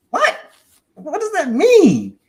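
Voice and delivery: very high-pitched, loud disbelief; baffled outburst. Retold or imitated. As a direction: squeal it, stretching the last words in mock confusion. Acting out baffled reaction to mentor.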